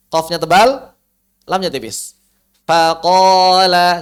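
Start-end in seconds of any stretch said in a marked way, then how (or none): 2.6-4.0 Faqala with thin fa, heavy qaf, thin lam; slow, loud Faqala with only qaf thick. Shown as a demonstration. Teacher models Faqala slowly for students to copy.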